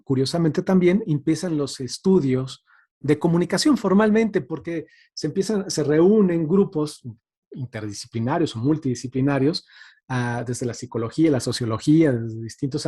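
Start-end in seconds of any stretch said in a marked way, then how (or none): none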